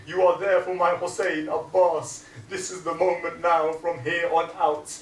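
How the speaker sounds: loud, high pleading charge to his son; dying father's charge. Acted. Dying Ali charging his son Abbas, loud and urgent. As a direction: press every word on him. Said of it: "he says to Abbas".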